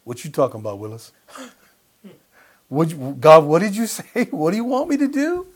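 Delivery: playfully mock a flustered Ananias questioning God; imitating Ananias. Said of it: "you can just hear Ananias right now".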